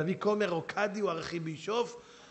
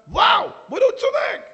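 much higher, loud surprised shout; amazed exclamation; 13 dB louder than usual. Retold or imitated. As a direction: burst out in realisation. Listener suddenly convinced.

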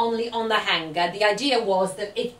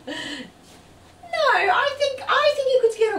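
excited; 14 semitones higher, slower than usual. Real, not acted. Mum defending outfit.

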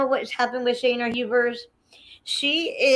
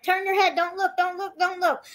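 higher-pitched mock urging; retold urgent urging; 8 semitones higher than usual. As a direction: urge him lightly, voice raised in pitch. Retold urging during thumb popping.

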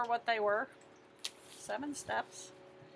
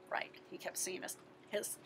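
whisper; 5 semitones lower, 12 dB quieter, faster than usual. Real, not acted. Teacher hinting at the answer.

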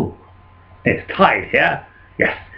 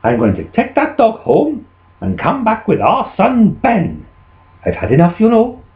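higher-voiced, emphatic scolding; fed-up farmer's wife; 6 semitones higher than usual. Wife fed up with farmer, high scolding. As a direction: tell him off, stretching the final complaint.

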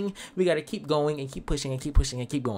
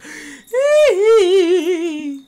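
high, loud evil cackle; evil laugh; 12 semitones higher, 6 dB louder than usual. Acted. Killer gloating over the body, evil cackle. After the kill.

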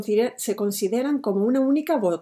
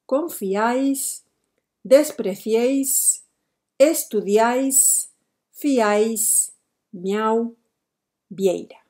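each word stretched out slowly; slow model words; much slower than usual. Shown as a demonstration. Teacher to students, slowly spelling out examples. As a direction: draw out every word so the triphthong is heard.

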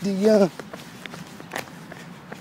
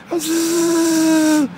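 zooming noise; 14 semitones higher, 4 dB louder than usual. Retold or imitated. Sprinting away from chasers.

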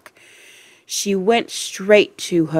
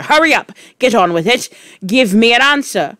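loud, high, hectoring orders; impatient shout; 6 semitones higher, 7 dB louder, faster than usual. A narrator's reading. Trunchbull pressing Wilfred for an answer, impatient. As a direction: hound the pupil for a reply.

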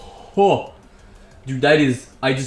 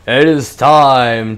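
dramatic announcer; 8 dB louder, much slower than usual. Pretend. Dramatic game start line.

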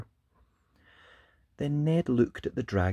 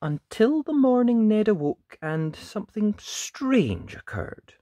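plain reading voice

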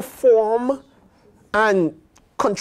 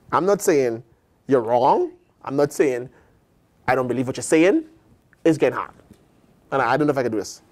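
ordinary delivery

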